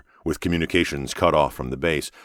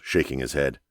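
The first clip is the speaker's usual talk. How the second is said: usual speaking voice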